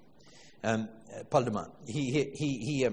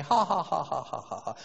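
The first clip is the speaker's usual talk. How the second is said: imitated laugh track.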